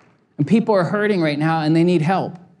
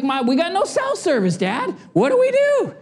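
put-on whiny kid; 12 semitones higher than usual. Kids complaining, no cell service.

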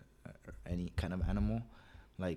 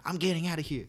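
put-on high, louder childish yell; whiny kid voice; 10 semitones higher, 4 dB louder than usual. Kid grabbing the phone to call 911, high yelling whine. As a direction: shout it high like a furious little kid.

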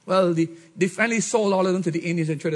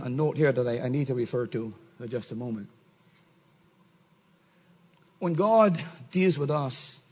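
ordinary delivery

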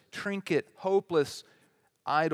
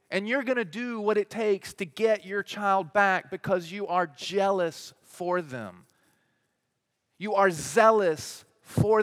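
louder and higher, slowing, building to a peak; full-force preaching; 5 semitones higher, 5 dB louder than usual. Acted. Preacher pleading with parents to fight for their child, loud and rising. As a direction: preach it hard, pressing every clause.

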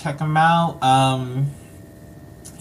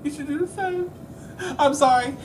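hammed-up tearful voice, pitched very high; overcome with emotion; 12 semitones higher, 5 dB quieter than usual. Host tells audience to follow their updates, mock-tearful. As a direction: squeak it out through mock sobs. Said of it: "I'm getting so emotional".

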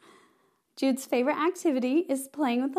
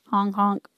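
clown honks; 3 semitones lower, 4 dB louder than usual. Pretend. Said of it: "we're gonna be silly".

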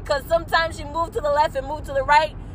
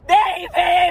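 "Be" punched loud and higher, short and sharp; shouted [name]; 4 semitones higher, 4 dB louder, slower than usual. Pretend. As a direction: yell it as a battle cry. Shouting the song line.

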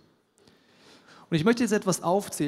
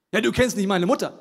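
mock objection; 4 semitones higher, 6 dB louder than usual. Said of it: "congregant objecting".

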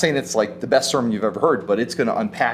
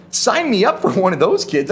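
higher and louder, eager to sign up; 7 semitones higher, 5 dB louder than usual. Retold or imitated. Preacher imagining hearing the promise, eagerly excited. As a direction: jump at the offer, louder and higher. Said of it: "I'd be like".

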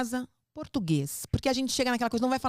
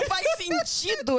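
high-pitched mocking kid complaint; filho indignado imitado; 12 semitones higher than usual. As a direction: mimic the child's protest, pitched way up. Repete a reclamação do filho. (imitação aguda de filho indignado)

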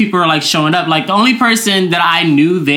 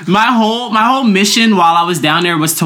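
normal talking voice

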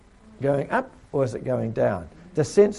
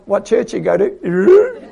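nosy question; 6 semitones higher, 8 dB louder, slower than usual. Retold or imitated. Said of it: "And then they ask".

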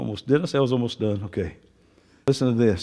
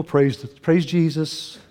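ordinary talk, nothing marked

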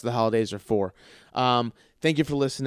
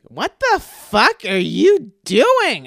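much higher, louder, slow mock-girlfriend outrage; imitating Zoe; 11 semitones higher, 14 dB louder, much slower than usual. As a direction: play the startled wife catching him, drawn out and high. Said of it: "she walks into the bathroom and she goes like".

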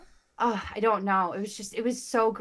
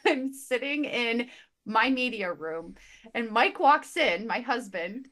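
ordinary talk, nothing marked

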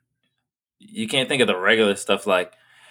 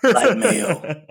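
mock Bane voice; 7 semitones higher, much slower than usual. Bane food order joke.

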